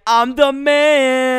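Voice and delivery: loud, drawn-out swagger; boastful hero. Acted. Acts out hero's boastful reply.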